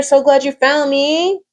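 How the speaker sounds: ordinary talk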